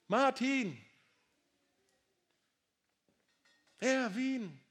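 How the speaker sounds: God calling each listener by name. Acted. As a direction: call each name out across the room.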